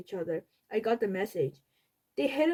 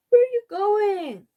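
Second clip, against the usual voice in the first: startled question; 11 semitones higher, 8 dB louder than usual. A narrator's reading. Mom asks Peter leaving.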